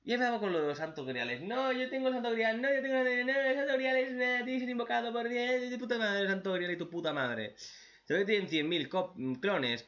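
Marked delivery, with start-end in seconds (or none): 1.4-7.6 mock-pompous boast pitched well up; sneering parody of a boastful hero; mocking character quote. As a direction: ham up a smug character bragging.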